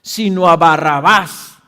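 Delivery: loud, slow crowd cry; slow shouted rejection of the prisoner; crowd shouting. A narrator's reading. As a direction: shout the refusal out slowly. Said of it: "volvieron a gritar diciendo".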